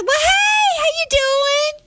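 very high, drawn-out mock-sweet greeting; sudden friendly cover-up. Parent caught freaking out greets the listening dad, high fake-friendly. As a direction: sing it out high, as if caught fussing.

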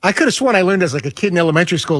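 ordinary talk

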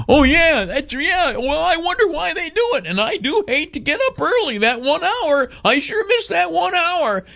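high, whiny mock voice of the average citizen. Mocking the public, lamenting the lost hour in a high whine. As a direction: whine like a gullible voter, pitched high. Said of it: "people like you and me".

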